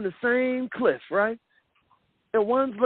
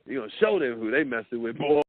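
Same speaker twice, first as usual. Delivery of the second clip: lower, swaggering tough-guy ego; a hint of a boastful street tough; mock bravado, lower and cocky; 8 semitones lower than usual. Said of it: "your ego keep being like".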